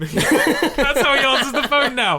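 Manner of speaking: slow, laughing through it; words stretched by laughter. Real, not acted. Laughing, drawn out.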